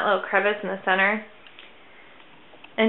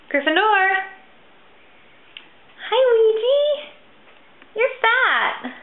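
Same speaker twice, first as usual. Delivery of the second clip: higher, louder teasing coo at the cats; 11 semitones higher, 4 dB louder, slower than usual. Real, not acted. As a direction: tease the cats in a high sing-song. Greets cats.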